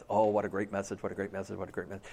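low mumbled compliments fading away; rote praise. Acted. Churchgoers filing past the pastor, mumbling rote praise.

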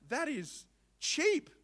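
slow, high exclaiming; amazed. Retold or imitated. Husband reacting to the price, slow and high. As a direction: savour how cheap it is. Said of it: "I was like".

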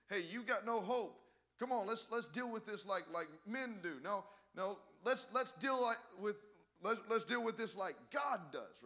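high, loud, urgent exhortation; forceful preaching. Acted. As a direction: fire it at the congregation. Preaches, voicing a confrontation.

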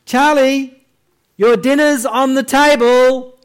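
high, loud impatient call; calling kids to dinner. Retold or imitated. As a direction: call out again, firmer. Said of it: "So you call your children for dinner".

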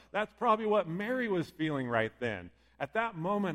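usual speaking voice

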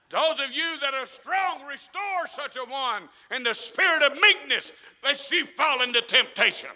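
loud, forceful scripture recital; preaching scripture. Acted. Preacher reminding the church what the Bible says, preaching. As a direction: preach the verse out strongly.

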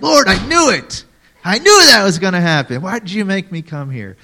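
high, loud exasperated outburst at God; mock-furious missionary yelling at the Lord; angry complaint. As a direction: throw the complaint at God, fed up.

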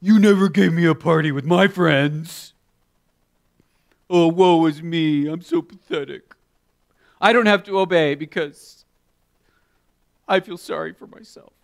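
loud, mopey pitiful whine; hammed-up sulking brother; self-pitying brother. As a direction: wallow in self-pity. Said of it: "he goes like this".

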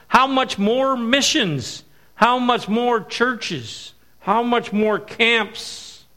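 preacher hammering rhetorical questions; slow rhetorical questions. Acted. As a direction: drive each question home slowly.

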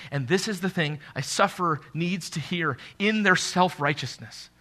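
normal talking voice

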